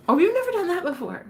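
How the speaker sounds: jumps up high, eager; a playful executive with a new idea; excited Google staffer. Retold or imitated.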